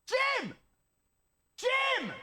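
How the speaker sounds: calling out. Real, not acted. Streamer calling out to [name], shouting. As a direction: shout his name to get his attention.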